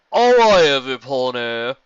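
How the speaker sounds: loud nasal announcer yell. Acted. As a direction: yell it through the nose. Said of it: "a nasally voice yelled".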